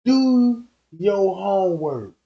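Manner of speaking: slow, stretched-out plea; retold appeal, slow and emphatic; begging. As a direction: draw out "pretty please" as you beg. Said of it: "When I beg everybody and say".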